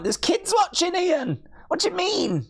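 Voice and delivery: high, prim mock telling-off; mock scolding. Streamer to Ian about his rude comment, prim mock scold. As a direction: chide him like a shocked auntie.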